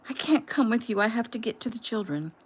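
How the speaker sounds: high, frantic pleading; desperate. Acted. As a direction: plead in rising panic.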